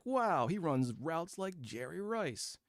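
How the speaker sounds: slow, awed mock praise pitched high; mock hype. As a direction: gush like an overexcited fan. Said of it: "They're like".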